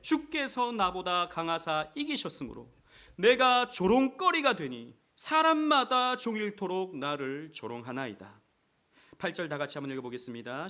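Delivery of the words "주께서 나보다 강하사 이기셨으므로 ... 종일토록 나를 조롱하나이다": slow, loud, lifted verse reading; slow fervent scripture. Acted. Preacher reads Jeremiah's lament aloud, slow and loud. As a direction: lean on every word of the lament.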